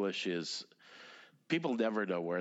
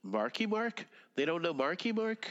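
high, incredulous double-take; can't believe they don't know him; surprised disbelief; 10 semitones higher than usual. Real, not acted. To his co-host, a high surprised disbelief. Disbelief that nobody knows [name].

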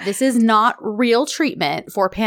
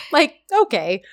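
amused sarcasm; 7 semitones higher than usual. Real, not acted. Laughing at doctor's advice.

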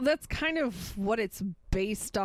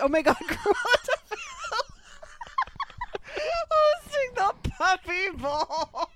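laughing. Real, not acted. Laughing at own idea.

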